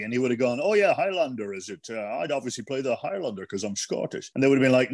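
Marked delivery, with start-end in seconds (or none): 0.6-4.3 mock Scottish film-star voice; Sean Connery. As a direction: imitate a Scottish actor eyeing a role. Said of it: "Sean Connery would have been asked".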